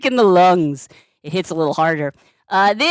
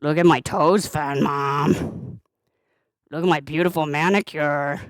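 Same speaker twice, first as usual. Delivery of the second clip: low, put-on pet voice; voicing the cat; 7 semitones lower than usual. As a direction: speak for the cat, lower than usual. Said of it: "Iggy says thank you".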